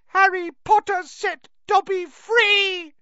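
very high, loud, shrill; shrill elf. Acted. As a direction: squeal it with joy. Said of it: "said the elf shrilly".

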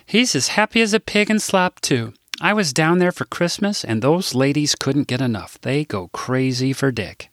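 put-on high, eager older Midwestern lady; chirpy assistant butting in with news; Rose's voice. Rose chatting about Christmas.

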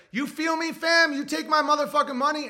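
high, insistent street challenge; acted-out escalating money dispute; mock violent threat. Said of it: "the way you're speaking is saying violence could happen".

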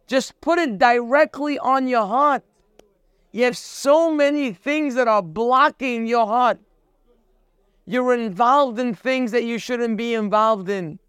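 lifted, fervent sermon delivery; preaching. Acted. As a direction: drive the message home to the congregation. Preaches the lesson to listeners.

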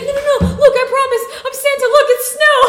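mock pleading Santa.

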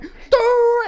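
high loud goofy duh; mock the dumb player's outburst; mock dumb yell. Zach mocking the flailing opponent with a yell.

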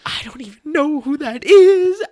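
high, loud, wailing mock sob; imitating crying. Retelling his childhood self sobbing after a belt beating. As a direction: blubber it out like a beaten kid. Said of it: "like, crying on the ground".

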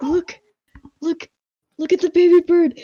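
put-on high and loud, insistent child; excited little kid. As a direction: imitate a boy begging his dad to look. Little brother voiced in story.

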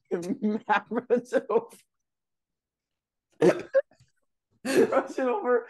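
high, squeaky laugh-talk; helpless giggling on the words. Real, not acted. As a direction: struggle to get it out through laughter. Cracking up telling the owl accident.

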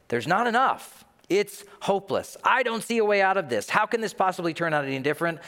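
high-pitched, exasperated hopelessness; despairing protest. Acted. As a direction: plead that there is no way out. Acted despairing protest to Jesus.

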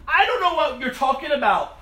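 loud, high-pitched protest; emphatic denial. Acted. Peter denying Jesus in front of everyone, emphatic denial.

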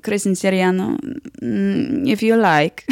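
reluctant Italian shrug in the voice; grudgingly allow it in an Italian accent; Italian-accented snob, unconvinced. Retold or imitated. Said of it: "an impression of him".

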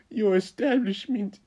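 high, wailing mock lament; wailing customer. Imitating the crying customer who lost money, high mock wail. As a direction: whine it like a ruined man. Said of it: "he was just like".

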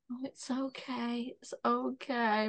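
hushed, drawn-out mock sympathy; snooty little lady. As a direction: imitate a snooty lady soothing someone. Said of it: "a snooty little lady came over".

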